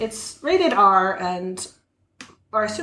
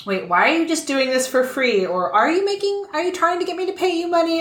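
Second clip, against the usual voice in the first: higher-pitched, puzzled disbelief; suspicious questioning; 8 semitones higher than usual. Acted. Customer quizzing the mechanic about working for free, suspiciously. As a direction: question it warily, pitch climbing as suspicion grows.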